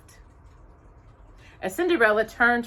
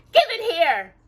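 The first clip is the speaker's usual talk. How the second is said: high, louder grabby demand; 11 semitones higher, 7 dB louder than usual. A narrator's reading. Drusilla grabs for the letter, demanding. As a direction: insist on getting it. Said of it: "Drusilla demanded".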